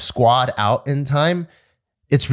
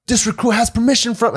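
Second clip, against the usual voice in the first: anxious high protest; 12 semitones higher than usual. Retold or imitated. Recruit protests to drill instructor.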